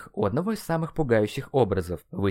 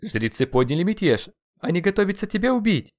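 high-pitched urgent warning; alarmed screech; 10 semitones higher, 3 dB louder than usual. A narrator's reading. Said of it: "верещал по всему Теночтитлану". (встревоженно верещит)

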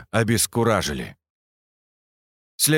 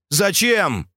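shouting; 9 semitones higher than usual. A narrator's reading. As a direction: yell the question. Narrator shouts the question.

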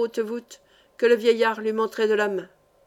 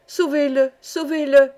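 higher, louder plea for help; narrator's raised, anguished appeal; heartrending cry; 4 semitones higher, 5 dB louder than usual. A narrator's reading. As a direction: cry out desperately for a rescue. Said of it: "s'écria Nell d'une voix déchirante".

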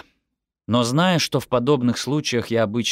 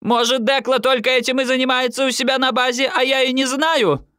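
high-pitched indignant demand; mother's outraged tirade; relentless scolding; 3 dB louder than usual. A narrator's reading. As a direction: keep nagging, pitch held up high. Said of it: "твердила своё мама".

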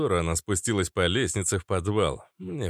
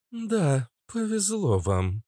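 mildly gloomy, higher-pitched remark; 9 semitones higher, slower than usual. A narrator's reading. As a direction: say it with a touch of gloom. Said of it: "мрачно проговорила Иштар". (мрачновато)

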